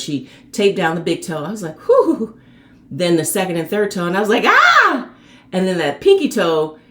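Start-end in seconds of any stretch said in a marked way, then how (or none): 1.9-2.4 retold pained whew. Toe taped, retold reaction. 4.5-5.1 high pained cry. Retold or imitated. Second toe taped, pain.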